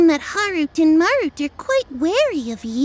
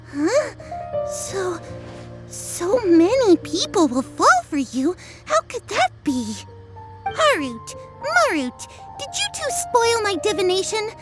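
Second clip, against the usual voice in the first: surprised; 5 semitones higher than usual. Acted. Fortune teller shocked by reading.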